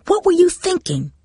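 high, sharp scolding mother; Mom yelling. A narrator's reading. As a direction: yell it like an angry mom. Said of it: "could almost hear Mom yelling".

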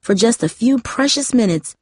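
ordinary delivery

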